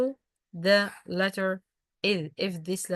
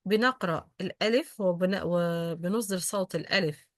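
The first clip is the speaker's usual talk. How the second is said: ordinary talk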